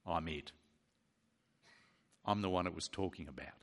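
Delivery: low, hushed, matter-of-fact claim; quiet declaration. Acted. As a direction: drop right down and state it simply. Acted understated claim by Jesus.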